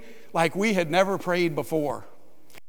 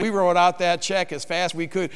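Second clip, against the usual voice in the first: ordinary talk, nothing marked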